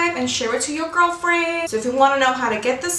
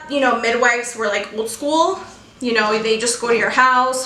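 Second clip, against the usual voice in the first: normal talking voice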